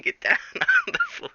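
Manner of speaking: very high squeak, breaking into a laugh; squeaky FBI shout. Acted. FBI agent squeaks orders at Jack, laughing. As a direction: squeal the order while cracking up.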